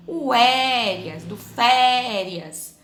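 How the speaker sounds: férias with a very long, strong É; stressed syllable stretched. Shown as a demonstration. Teacher finding the strongest syllable, férias with É drawn out. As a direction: hold the É of férias, slowly. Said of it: "a sílaba mais forte".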